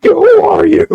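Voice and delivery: high, loud bewildered shout; mock yell. Tim Robinson answering a stranger on the podcast, loud bewildered yell. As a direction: yell it out of nowhere, baffled. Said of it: "and he just goes".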